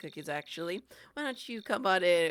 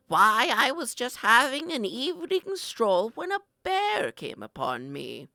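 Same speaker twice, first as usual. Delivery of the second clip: loud, dramatic storytelling grandma; feisty grandma; 4 semitones higher, 8 dB louder than usual. Pretend. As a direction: spin the tale grandly. Grandma tall tale.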